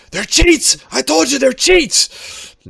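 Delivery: high furious yell from afar; shouting accusation. Acted. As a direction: scream it across the room. Bystander shouts from across.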